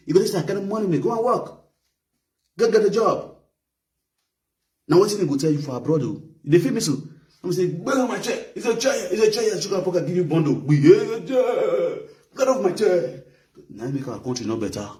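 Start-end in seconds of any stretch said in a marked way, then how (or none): 0.4-3.3 mock dismissive president. Imitating a president brushing off begging. 7.8-13.2 high, hammed-up shout to get off his chair; mock angry shout. Man mocking a rival who wants his chair, high mock yell.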